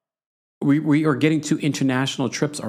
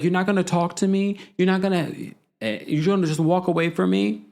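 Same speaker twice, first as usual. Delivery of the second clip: retold indignant, higher-pitched challenge; mock indignant; 8 semitones higher than usual. Commentator quoting a housewife confronting her departing castmate, mock indignant. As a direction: imitate an affronted woman, pitched up.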